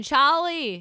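loud, high Boston holler; Boston accent. Acted. Teasing co-host about the Bruins visit, Boston accent. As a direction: call out the name like a Boston fan. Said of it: "Your Boston accent needs work".

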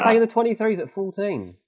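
high, incredulous question; can't believe what you just heard; astonished. Real, not acted. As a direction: react amazed, pitch shooting up. Astonished at the young player's level.

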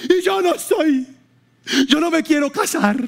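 high, sharp protesting outcry; retold cry of protest. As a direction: cry out that you refuse to marry. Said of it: "pega un grito y dice". (grito agudo de protesta)

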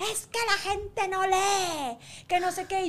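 high, slow mimicry of an annoyed man; mock grumbling man. Mocking the man in line, slow and high. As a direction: ape the grumbling stranger in line. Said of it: "un señor atrás me ha empezado a formar un lío".

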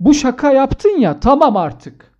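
loud, high mock outrage. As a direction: blow up in fake fury at the joke. (şakaya sahte öfkeyle bağırarak)

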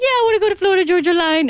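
high, quick pet voice; dog voice. Retold or imitated. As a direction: answer as the dog, eagerly. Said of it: "speaking like this with other people around you".

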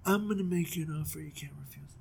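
husky whisper, fast; whispered Godfather line. Retold or imitated. King makes the brothers an offer, mock Godfather whisper. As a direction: breathe the offer like a mob boss. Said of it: "And he goes".

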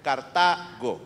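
"Cartago" broken as carTA, then go; stress on second A. Shown as a demonstration. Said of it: "con acento en la segunda A".

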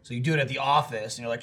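ordinary talk, nothing marked

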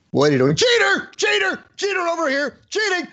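high, loud tattletale shouting; mocking the guy who caught him cheating on his driving test; mock outraged yelling. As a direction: yell it out to the whole room, pointing. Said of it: "caught me cheating".

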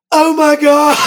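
high, loud gushing oh my God. Retold or imitated. Rock star sees a beagle, high loud gush. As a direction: melt over the cute dog.

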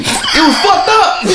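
high laughing, slow. Real, not acted. Cracking up telling his friend it was messed up. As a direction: laugh through the words.

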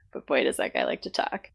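ordinary talk, nothing marked

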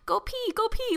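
put-on very high toddler-speak; little toddler. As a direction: chant it in a tiny child voice. Imitates toddler nephew.

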